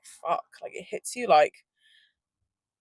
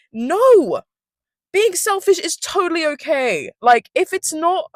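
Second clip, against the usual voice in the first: emphatic; 10 semitones higher, 6 dB louder, slower than usual. Real, not acted. Emphatic defense of selfishness.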